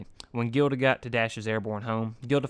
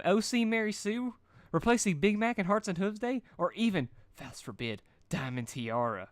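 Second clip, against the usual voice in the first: climbing higher through the list; frantic high Pinkie rant; Pinkie escalating; 6 semitones higher than usual. Acted. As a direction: rattle off worse and worse names. Pinkie listing fears.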